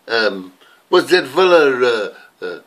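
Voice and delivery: usual speaking voice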